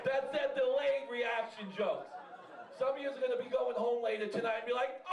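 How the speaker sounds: usual speaking voice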